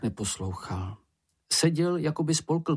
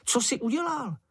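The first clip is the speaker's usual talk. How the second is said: high-pitched, astonished question; small character exclaiming, pitched way up; Čenich's excited cry; 13 semitones higher than usual. A narrator's reading. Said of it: "vykřikl Čenich".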